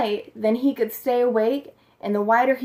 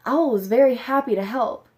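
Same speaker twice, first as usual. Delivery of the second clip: plain reading voice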